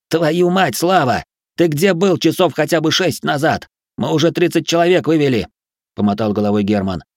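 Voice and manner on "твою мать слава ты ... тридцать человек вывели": high, heated voice; exasperated protest. A narrator's reading. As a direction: argue back in exasperation. Exasperated quarrel over changing plan.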